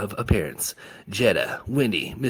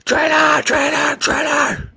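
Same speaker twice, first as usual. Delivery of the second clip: even higher, louder breaking yell; yelling, crying out; 11 dB louder, slower than usual. A narrator's reading. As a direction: scream it with tears in the throat. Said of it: "yelled out".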